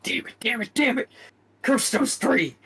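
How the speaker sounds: high, angry repeated curses; frustrated cursing. Acted. Villain raging alone in his cave. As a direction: hurl the curses while smashing things. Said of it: "he's throwing stuff everywhere".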